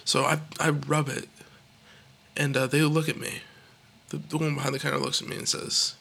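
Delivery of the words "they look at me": usual speaking voice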